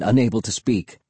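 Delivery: usual speaking voice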